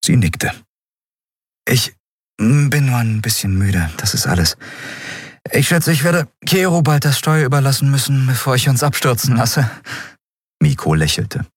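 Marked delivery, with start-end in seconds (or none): 1.7-9.7 raised, lightly weary captain's voice; captain Endriel, tired. Acted. Captain tells Miko she is tired, lightly weary voice. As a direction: play the worn-out captain making light of it.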